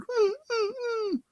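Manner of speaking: high, soft dog whimper. A narrator's reading. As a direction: whine like a sad giant dog. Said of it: "The monster whimpered".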